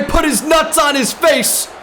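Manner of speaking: high, loud hype shouting at a big play; excited shout. Real, not acted. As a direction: yell it like the crowd just erupted. Shouted excited game commentary.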